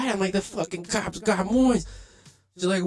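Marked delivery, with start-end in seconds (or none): 0.3-1.9 much higher, slower alarmed report; panicked. Retold or imitated. Telling the girlfriend the cops got Moise, high and alarmed. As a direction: spell out the bad news in shock.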